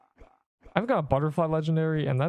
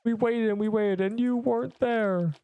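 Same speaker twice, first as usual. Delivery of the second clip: higher, dramatic mock Tony Stark reproach; over-the-top movie quote, pitched up; 8 semitones higher than usual. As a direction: ham up the hero's wounded reproach to Cap. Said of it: "When Tony Stark told Cap".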